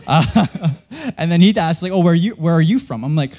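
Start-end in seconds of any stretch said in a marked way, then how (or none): none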